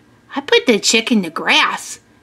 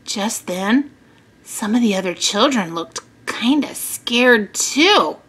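plain reading voice

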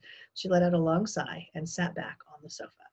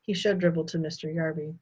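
ordinary delivery, nothing marked

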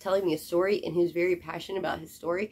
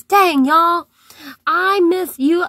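excited greeting; 8 semitones higher, 10 dB louder, slower than usual. Real, not acted.

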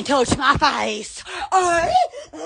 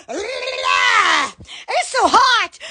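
It's so hot stretched very slowly, every word dragged; drawn-out moan; much slower than usual. Real, not acted. Vlogger moaning about the heat, slowly drawn out. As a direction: drag out so hot like a long groan.

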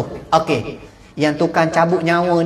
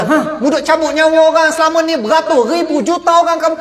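higher, louder scolding challenge; stern rebuke; 8 semitones higher, 4 dB louder than usual. Acted. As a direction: scold him from above. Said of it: "Allah kata".

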